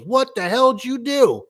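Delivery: put-on high, slow indignant old man; a high outraged old-timer; angry old man. Old customer angry at installer.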